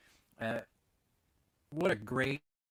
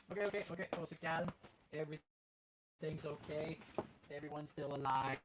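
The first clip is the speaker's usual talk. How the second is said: soothing the dog; 8 dB quieter than usual. Real, not acted. Calming the startled dog.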